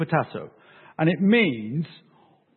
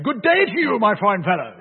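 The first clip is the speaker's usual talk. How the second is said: hammed-up, high, loud salutation; overblown, jovial formal hello; hearty greeting; 5 semitones higher, 5 dB louder than usual. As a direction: hail a stranger with comic grandeur. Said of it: "I'm actually going up and saying".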